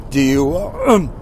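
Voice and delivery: about to choke, voice catching; words cut off by choking. Real, not acted. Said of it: "excuse me, I'm choking on".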